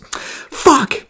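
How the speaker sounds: put-on high, sudden curse from a frustrated kid; imitated kid cursing. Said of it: "And he goes".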